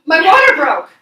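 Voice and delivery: high, very loud announcement; retold shout. Re-enacting the water breaking on the phone, high shout. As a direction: shout it as sudden news, re-enacted.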